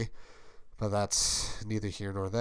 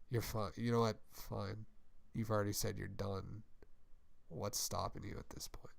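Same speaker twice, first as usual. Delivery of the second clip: cold hush; 3 semitones lower, 13 dB quieter than usual. Acted. Nick's cold reply in quarrel.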